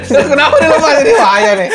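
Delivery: high laughing question; amused high-pitched tease. Real, not acted. Teasing about the hidden Arsenal flag. (tertawa geli)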